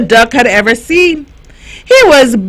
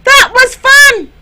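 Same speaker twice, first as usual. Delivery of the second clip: excited; 13 semitones higher, 4 dB louder than usual. A narrator's reading. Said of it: "said Tilly".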